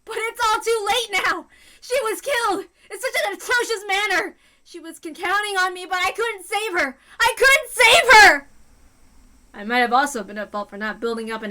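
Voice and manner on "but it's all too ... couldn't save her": distraught tearful outburst. Acted. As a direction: fall apart with guilt, building to a cry. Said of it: "calm down".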